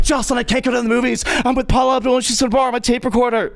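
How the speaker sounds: high, fast, starstruck gushing; giddy phone brag. Retold or imitated. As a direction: blurt the news to your friend on the phone.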